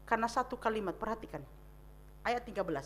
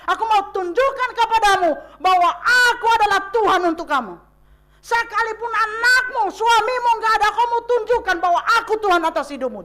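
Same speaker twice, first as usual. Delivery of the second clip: commanding Jesus; 6 semitones higher, 6 dB louder than usual. Acted. Jesus speaking to the widow.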